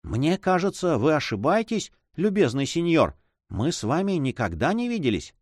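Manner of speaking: hint of a high-voiced monk; lightly voiced monk. A narrator's reading.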